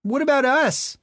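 put-on high, loud indignant old woman; angry mother. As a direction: object shrilly like a bitter mother. Mother objects.

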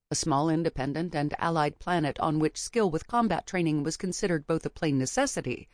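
ordinary delivery

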